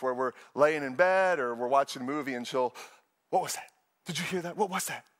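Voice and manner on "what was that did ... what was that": lightly voiced worried questioning; mock spooked wife. Wife to husband on hearing a noise, hushed mock alarm. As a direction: imitate a jumpy wife, slightly hushed.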